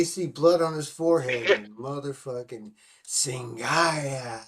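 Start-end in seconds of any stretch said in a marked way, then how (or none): 1.7-4.4 very slow, drawn-out mock chant. Podcaster acting out tribesmen spotting blood, mock pronouncement. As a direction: stretch the word right out like a film tribe's verdict.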